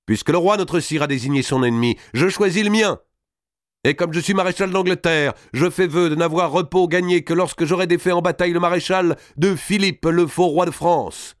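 high, ringing oath, slowing on the last words; fervent martial declamation; proclaiming knight. Acted. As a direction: stand and swear before the king. Salisbury stands and pronounces his vow.

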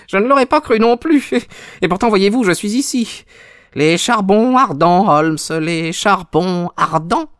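high-pitched, mocking villain; gloating visitor. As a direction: taunt the sick man in a high voice. (visiteur moqueur à la voix aiguë)

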